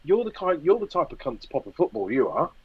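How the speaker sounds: acted-out grumbling tirade; mock rant. Acts out rant at shopper.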